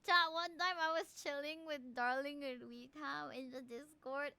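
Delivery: usual speaking voice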